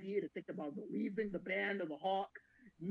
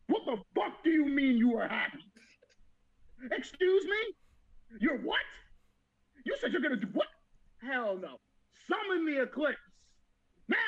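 loud, indignant mock fury; hammed-up furious commander; mock outrage; 3 semitones higher, 5 dB louder than usual. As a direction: yell it like a jilted leader. Griffith voiced, outraged at Guts.

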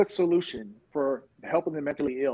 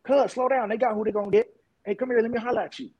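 much higher voice, imitating a street guy; stranger calling out; 8 semitones higher than usual. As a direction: call a runner back, pitched up. Retold stranger calling him back.

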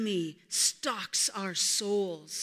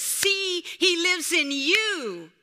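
higher, louder, slow joyful reveal; excited wise baboon exclaiming; triumphant cry; 8 semitones higher, 6 dB louder, slower than usual. Acted. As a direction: proclaim it with delight.

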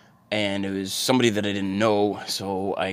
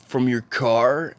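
higher, louder and slow, disbelieving; incredulous; 5 semitones higher, 6 dB louder, slower than usual. Retold or imitated. Stranger hearing of a podcast from a car, incredulous. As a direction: drag it out in disbelief. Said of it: "And he said".

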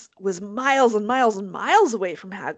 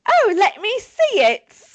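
high-pitched, louder acted householder; hammed-up curious resident; door answerer; 11 semitones higher, 5 dB louder than usual. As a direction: play an eager homeowner at the door. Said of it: "I'm gonna be the person who answers the door".

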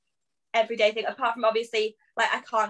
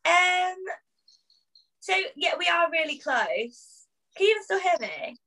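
glitchy, breaking-up call audio; choppy frozen video call; buffering stutter; 7 semitones higher than usual. Real, not acted. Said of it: "'Cause you froze".

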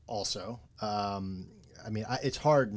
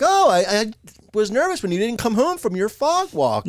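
higher, louder prim neighbour wife; louder, higher mock housewife; imitating Maude; 9 semitones higher, 9 dB louder than usual. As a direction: sing it out high like a fretting churchy wife. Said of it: "even Maude says".

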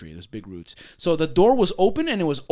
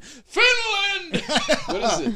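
high, drawn-out Finnish accent attempt; mock Finnish accent; much slower than usual. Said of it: "What's the accent of someone from Finland?".

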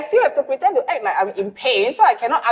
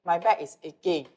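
a softer request that trails off; 6 semitones lower, 6 dB quieter than usual. Retold or imitated. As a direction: ask him quietly, letting it fade. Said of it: "if I follow your style".

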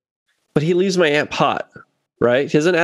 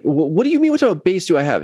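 incredulous echo; 3 semitones higher, much faster than usual. Retold or imitated. Bassist answering the sound guy's bizarre question, incredulous. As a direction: throw the question back in disbelief. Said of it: "Bizarre questions".

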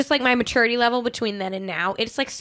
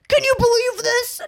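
much higher, imitating an indignant date; mock outburst; 12 semitones higher than usual. Quoting the date's outburst with mock indignation. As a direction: mimic his outraged disbelief.

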